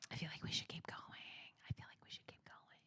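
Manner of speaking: very soft, voiceless whisper. Real, not acted. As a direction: whisper it as if thinking to yourself. Thinking aloud about carrying on.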